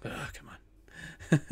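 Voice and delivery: breathy drawn-out groan; exasperated groan. Real, not acted. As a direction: sigh it out, slow and fed up. Frustrated at terrain.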